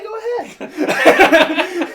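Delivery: slow loud laughing taunt; mocking taunt. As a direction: dare them, drawn out.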